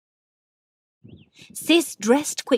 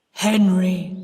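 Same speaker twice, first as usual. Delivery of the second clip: a grave ghost naming himself; lightly deepened, falling name; solemn ghost reveals his name; same deep voice; 2 semitones lower, 5 dB louder than usual. A narrator's reading. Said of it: "in the same deep voice".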